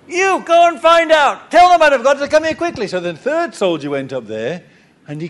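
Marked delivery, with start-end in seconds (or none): 0.1-2.9 high, loud, fast angry shout; furious royal order. Retold or imitated. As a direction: yell the command impatiently. King orders third soldier.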